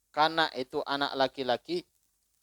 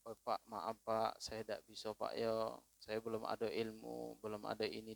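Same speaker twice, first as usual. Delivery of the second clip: polite soft refusal; 4 semitones lower, 13 dB quieter than usual. Acted. Polite refusal acted in sermon.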